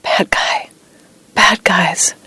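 unvoiced whisper of a nightmare; narrated sleep-talk whisper. A narrator's reading. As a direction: whisper it as if talking in troubled sleep. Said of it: "thrashing in bed and whispering".

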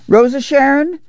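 high, rising call; sing-out call to a daughter. A narrator's reading. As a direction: summon the girl with a lifted voice. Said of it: "Ma called".

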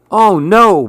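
high, loud cry of alarm; a hammed-up wail of despair; dismayed cry. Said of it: "Billy cried".

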